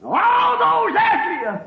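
high, drawn-out pulpit shout; soaring revival-preacher call; full-force preaching. Real, not acted. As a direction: cry the promise out slowly and loudly. Preacher shouts God's promise.

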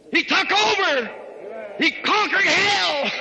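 exultant climax from the pulpit; triumphant shout. Real, not acted. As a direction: proclaim the victory in short, ringing bursts. Climax of Christ's victory over hell.

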